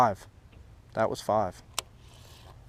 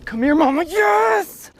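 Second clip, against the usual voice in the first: high, loud joyful cry; excited shout; 4 dB louder, slower than usual. Real, not acted. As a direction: yell as the fish lands. Landing big fish, excited.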